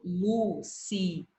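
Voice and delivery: Luciana slowly split, I and A in separate syllables. Shown as a demonstration. Teacher showing student the split vowels, syllable by syllable. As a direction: break Luciana into syllables, pausing between them. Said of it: "Eu falo".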